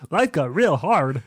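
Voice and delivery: slow, loud comedian impression through laughter; Bobcat Goldthwait impression. Co-host playing the disguised sound engineer, slow hammed-up impression. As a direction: drag it out as a washed-up comic. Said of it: "Andrew is Bobcat Goldthwait in disguise".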